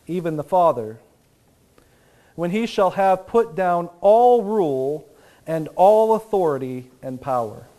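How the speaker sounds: ordinary talk, nothing marked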